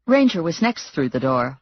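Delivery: usual speaking voice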